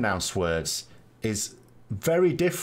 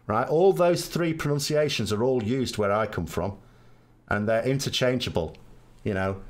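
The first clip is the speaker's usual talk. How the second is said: ordinary talk, nothing marked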